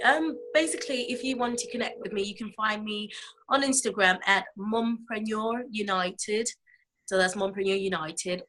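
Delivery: usual speaking voice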